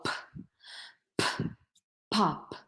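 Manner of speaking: p and pop with lots of air; aspirated p demo. Shown as a demonstration. Teacher showing English breathy p sound. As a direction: puff out each p, then pop. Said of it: "we use a lot more air".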